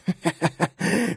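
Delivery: high staccato cackle; mocking villainous laughter; venomous laugh. A narrator's reading. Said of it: "человек ядовито расхохотался".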